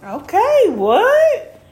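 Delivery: high startled what; surprised. Real, not acted. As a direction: caught off guard, squeak it. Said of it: "surprised reaction to twist".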